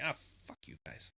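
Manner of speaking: slow, breathy whispered fuck-off; whispered curse. Retold or imitated. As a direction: sigh it out in a weary whisper. Annoyed at idea thieves.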